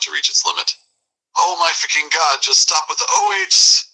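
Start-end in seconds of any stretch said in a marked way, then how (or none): 1.4-3.8 high rising mock scream; shriek, climbing very high. Enraged man screaming at the O Hs, very high. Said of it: "He is now screaming".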